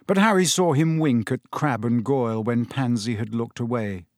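ordinary delivery

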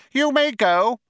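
very high-pitched, sharp little mouse. A narrator's reading. Mouse dismisses the old man, sharply. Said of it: "said Benji Mouse sharply".

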